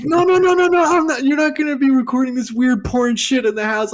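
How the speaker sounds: mock furious mom; imitating angry mom. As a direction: forbid it like an outraged mother. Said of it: "she's like".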